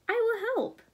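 little dinosaur eager to help; eager high voice. A narrator's reading.

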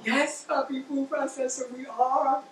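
high, indulgent pretend reply; playful reassurance. Cook confirms the show to her food processor, high and playful. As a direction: humor an excited imaginary friend. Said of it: "And he's so happy".

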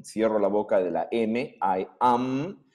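normal talking voice

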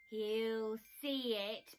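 slow, smiling reassurance; calm and sure; with a smile. Acted. Bear reassuring Hedgehog with a smile. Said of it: "Bear said with a smile".

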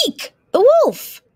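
loud, very high squeal of alarm; frightened shriek. Acted. Little Red suddenly sees the wolf, frightened shriek. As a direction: shriek at the sight of danger.

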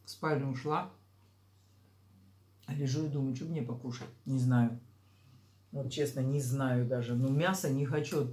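speaking between bites; with food in mouth; chewing while talking. Real, not acted. Seen 0: Took a bite at -3.0; jaw and lips still working through the words.

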